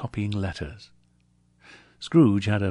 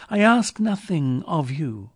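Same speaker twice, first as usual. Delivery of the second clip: high, louder protest; pitched-up heartfelt insistence; earnest nephew; 8 semitones higher, 6 dB louder than usual. A narrator's reading. Nephew assuring his uncle, earnest.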